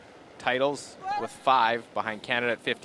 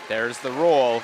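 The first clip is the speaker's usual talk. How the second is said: excited play-by-play; 2 semitones higher, 9 dB louder, slower than usual. Real, not acted. Commentator reacting to key shot.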